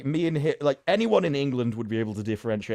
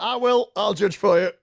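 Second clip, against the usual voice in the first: emphatic retort; 9 semitones higher, 6 dB louder than usual. Retold or imitated. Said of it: "I'm like".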